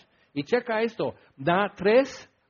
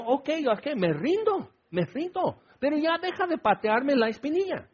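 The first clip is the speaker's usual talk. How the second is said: mock surrender, pitched higher; giving up, attacked; 8 semitones higher than usual. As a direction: throw up your hands and beg him to stop. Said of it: "así me sentía agredido". (rendición fingida, exasperada y más aguda)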